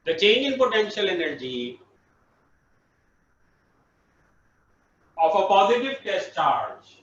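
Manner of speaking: slow, measured dictation. Real, not acted. Teacher dictates a definition for students to write, slowly. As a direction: spell it out slowly for note-taking.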